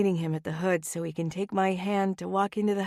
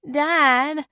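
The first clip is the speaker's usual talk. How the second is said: put-on high drawn-out child's whine; a small girl complaining to her dad; child whine, much higher and louder; whining little girl; 7 semitones higher, 7 dB louder than usual. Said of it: "Rosie whines".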